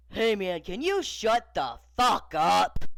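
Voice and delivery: loud, slowing into a punched ending; fed-up holler, stretched out; exasperated shout. Acted. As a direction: shout at someone who won't stop. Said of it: "he finally shouted at the Snoop doll".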